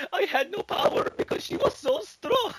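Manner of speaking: high, slow fake sob; mock crying. Boris on TV, high and fake sobbing. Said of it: "It's like".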